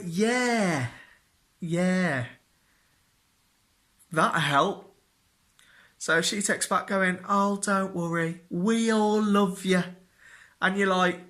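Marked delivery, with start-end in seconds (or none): 0.0-4.9 sarcastic yeah. Retold or imitated. Sarcastic reaction to mum's text. 7.3-9.8 higher, slow, cooing mum voice; sweetly reassuring mother; mock mum. Mum texting back.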